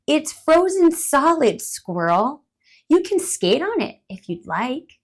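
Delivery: helpful bird. Acted. Helpful bird. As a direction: bird tells squirrel he can skate.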